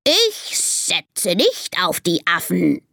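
slow, put-on parrot mimicry; babbling parrot. Parrot aping the snake to annoy it, slow squawky mimicry. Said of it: "plappert der Papagei".

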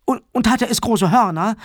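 put-on high, stammering little girl voice; probing child. A narrator's reading. Sarah digs for details about the monster, high and insistent. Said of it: "bohrte Sarah nach".

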